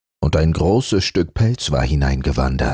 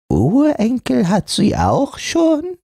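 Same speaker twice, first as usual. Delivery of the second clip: put-on high, astonished old scrap; a grey old muslin wondering; old muslin surprised from the depths; old amazed voice. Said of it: "alte, zittrige Stimme verwundert".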